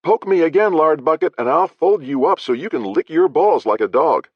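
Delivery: high, loud snarling threat; furious threat. Acted. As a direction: raise it, threaten him to his face. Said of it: "Jane's glare could have melted rock".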